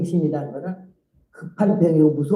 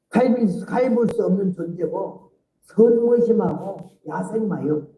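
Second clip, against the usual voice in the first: normal talking voice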